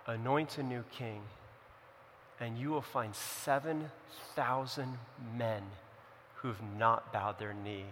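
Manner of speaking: low, soft voice of God; hushed, near whisper. Retold or imitated. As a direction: speak low and tenderly, with long pauses between phrases. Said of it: "the Bible says in a whisper".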